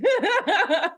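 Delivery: loud, high peal of laughter; real laugh of agreement. Real, not acted. Laughs while agreeing with host.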